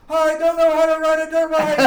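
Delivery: loud, high, slowed, half-laughing yell; laughing panicked scream. Retold or imitated. Rider racing downhill on a dirt bike, laughing panicked scream. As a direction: scream it through laughter as you lose control. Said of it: "I started screaming".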